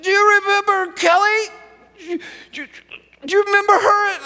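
put-on high child's voice, near tears; tearful little girl. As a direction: voice a six-year-old starting to cry. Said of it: "She said".

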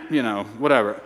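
ordinary talk

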